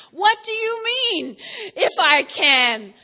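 high, loud, slowed disbelief; incredulous retort. Retold or imitated. Jesus answering the doubting father, incredulous. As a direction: throw the doubt back at him.

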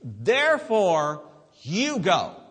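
loud commissioning, big pauses between phrases; forceful preaching. Real, not acted. Preacher voices Jesus commissioning disciples, forceful proclamation. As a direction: send them out with force.

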